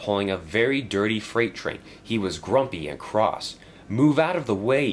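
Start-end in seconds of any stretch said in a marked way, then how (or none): 3.9-4.9 a hint of oily menace, a bit louder; smooth, slick threat, lightly voiced. A narrator's reading. Diesel threatening Stepney off the line, a touch oily and pushy. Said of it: "he said in an oily voice".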